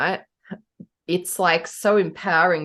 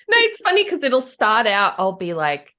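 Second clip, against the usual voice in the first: normal talking voice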